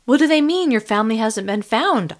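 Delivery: high, loud angry demand; furious challenge. Acted. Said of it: "I demanded, angry and upset".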